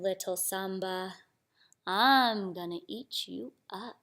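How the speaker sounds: slow, low, softly threatening tiger; menacing tiger. Acted. Tiger threatening to eat little Samba, slow quiet menace.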